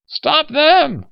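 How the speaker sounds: high, loud furious scream; slobbering shriek. Acted. Old man yells after fleeing aliens, slobbering shriek. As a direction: scream for them to be stopped. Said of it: "David Unger slobbered".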